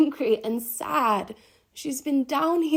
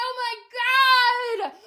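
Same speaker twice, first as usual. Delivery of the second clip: very high, loud, stretched-out wailing cry; drawn-out yell; 13 semitones higher, 4 dB louder, slower than usual. Acted. Wild woman down below yells at you, anguished. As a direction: yell it long and high, nearly singing. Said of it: "And she yells again".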